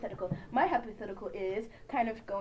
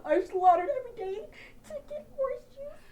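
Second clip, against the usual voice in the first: put-on high whiny preachy kid; 12 semitones higher than usual. Mock vegan kid, high.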